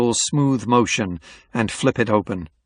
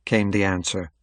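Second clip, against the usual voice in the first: plain reading voice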